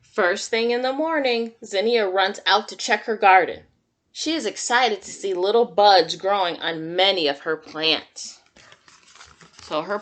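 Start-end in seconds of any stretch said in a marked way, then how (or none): 0.0-8.4 put-on reading to children. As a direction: read it like storytime for little kids. Reads picture book to children.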